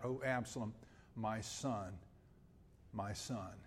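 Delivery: a father mourning his son; grieving cry. Retold or imitated. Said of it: "And he cries out".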